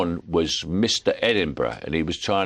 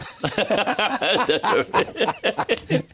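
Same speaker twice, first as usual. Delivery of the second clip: high delighted laugh; laughing at his own story; a gleeful cackle; 14 semitones higher than usual. Real, not acted. Laughs at the punchline.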